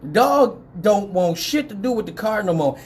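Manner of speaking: ordinary talk, nothing marked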